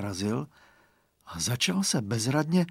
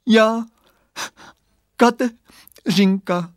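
high, slow voice broken by crying; a small girl sobbing out her name; tearful little voice; 9 semitones higher, 8 dB louder, much slower than usual. A narrator's reading. Kateřinka answering Radovan from the fog, tearful. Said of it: "hlásek pláčem potrhaný na kousky".

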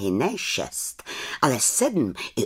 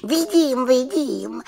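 eager little witch; 13 semitones higher, 4 dB louder, slower than usual. Acted. Witch answers raven.

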